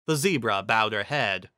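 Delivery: usual speaking voice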